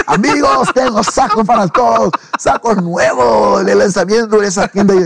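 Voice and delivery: high, excited hawker's cry; retold calling out. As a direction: call out gifts to every friend. Calling out at doors, giving suits.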